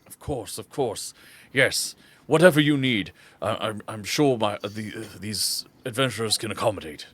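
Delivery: formal, hesitant father character; lightly voiced dignified father; obliging, courteous father. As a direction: agree readily, with polite stumbles. Game master plays the father.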